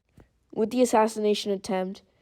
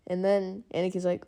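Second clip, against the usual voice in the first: ordinary delivery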